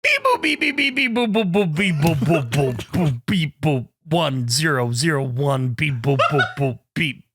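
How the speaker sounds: beeping and booping like a little robot; toy robot voice. Pretend. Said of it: "use my native tongue for this".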